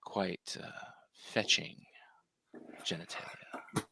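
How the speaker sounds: breathy erotic whisper. Pretend. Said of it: "you said to do it in a breathy voice?".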